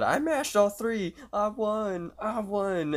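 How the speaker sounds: very high-pitched winner's squeal; excited squealing scream. Retold or imitated. Prank victim believing he won the lottery, squealing excitedly. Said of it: "he screams out".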